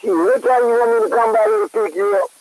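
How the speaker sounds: ordinary talk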